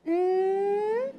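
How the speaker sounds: "N" as one long hummed nnn; sustained letter sound. Shown as a demonstration. Trainer correcting a short nuh, long continuous "N" sound. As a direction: hold "N" on the nose, no vowel after it. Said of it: "I hear someone saying n".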